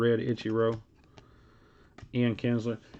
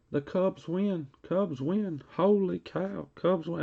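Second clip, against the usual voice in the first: Freddie Freeman screaming; 7 semitones higher, slower than usual. Retold or imitated. Mock Freddie Freeman ballpark call for the card-break viewers. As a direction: ham up a ballpark home-run call, pitched high. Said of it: "Freddie Freeman screaming".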